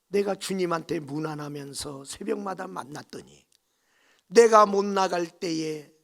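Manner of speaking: usual speaking voice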